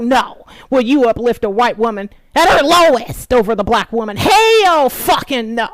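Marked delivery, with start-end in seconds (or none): 4.2-5.7 slow, high, loud refusal; defiant shouted refusal; furious slow no. Real, not acted. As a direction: drag out each word in outrage. Emphatic outraged refusal.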